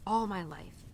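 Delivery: ordinary talk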